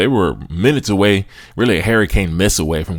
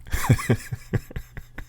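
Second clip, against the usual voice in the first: real wheezy, breathy chuckle; soft, voiceless laugh; 5 semitones higher, 8 dB quieter than usual. Real, not acted. Host amused at what English fans would say, laughing. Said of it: "I'm laughing 'cause".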